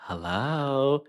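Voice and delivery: soft, coy hello imitated; quiet mock phone greeting; Sheree. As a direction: imitate a woman softly announcing herself on the phone. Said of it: "And I hear".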